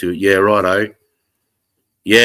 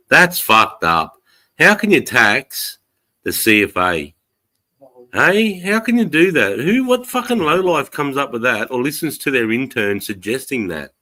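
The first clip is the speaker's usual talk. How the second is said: outraged; 6 semitones higher than usual. Real, not acted. Outraged at CFA tax.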